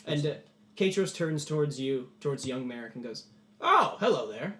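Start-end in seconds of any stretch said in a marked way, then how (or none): 3.6-4.5 higher, louder surprised hello; lordly greeting. Acted. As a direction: greet the newcomer with a touch of grandness. Lord greets young boy.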